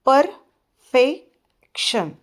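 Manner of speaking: परफेक्शन slowly in three separate beats, per-fec-tion; syllable breakdown. Shown as a demonstration. Teacher showing a three-syllable word, broken syllable by syllable. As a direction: break परफेक्शन into par, fek, shan with pauses between. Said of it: "word को break करके".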